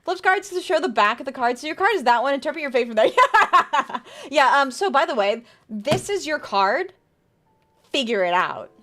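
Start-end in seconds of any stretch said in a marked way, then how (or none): 3.2-4.3 short high laugh. Real, not acted. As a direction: burst out laughing. Laughs at chat joke.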